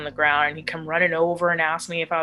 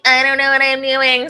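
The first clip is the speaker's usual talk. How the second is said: mock helpless, pitched up high; playing her spoiled bougie self, higher and louder; 8 semitones higher, 6 dB louder than usual. Retelling her bougie self looking up rehabs, mock helpless high voice. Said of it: "my bougie ass was like".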